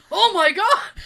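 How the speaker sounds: loud, high laughing gasp; shocked exclamation. Retold or imitated. Jay reacting to news of the urine test, loud and shocked.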